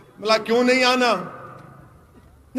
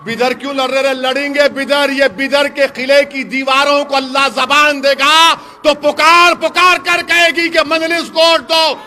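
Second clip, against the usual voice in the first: climbing from firm to a full shout; building to a shout; 4 semitones higher, 8 dB louder than usual. Acted. Speaker urging the crowd to own its history, rising to a shout. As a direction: build the crowd up till you roar. Rally climax about Bidar fort.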